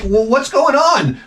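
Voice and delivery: lightly voiced puzzled woman; high curious woman. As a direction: ask it like a puzzled lady. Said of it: "she's like".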